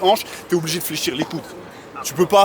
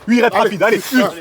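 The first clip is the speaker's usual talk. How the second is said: high, loud coach shouting reps; shouting encouragement; 4 semitones higher, 7 dB louder, slower than usual. Real, not acted. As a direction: push the athlete through the last reps. Coach pushing final fast reps.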